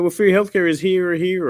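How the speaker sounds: lightly voiced smug foreigner; mock foreigner. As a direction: toss it off like it's no big deal. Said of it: "It's like".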